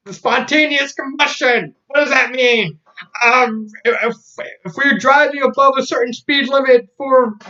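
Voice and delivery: high, urgent mock panic; hammed-up alarmed cartoon voice; frantic warning. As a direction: explain it in comic desperation. Steven's frantic warning, acted out.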